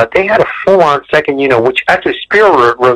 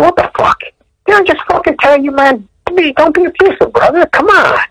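pitched way up, mock-heated telling-off; angry boss confronting an abusive assistant director, pitched up. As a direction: retell chewing out the bully AD in a high, heated voice.

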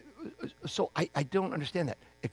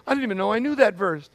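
higher, louder, fast and breathless with wonder; amazed retelling; 7 semitones higher, 4 dB louder than usual. Retold or imitated. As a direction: blurt it out like you can't believe it happened. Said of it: "walk away from the person and go".

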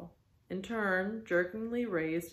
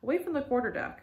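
high-pitched order to clear off; crying out; 9 semitones higher, 5 dB louder, faster than usual. Acted. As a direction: call out a scolding command, pitched up. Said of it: "cried the Manxman".

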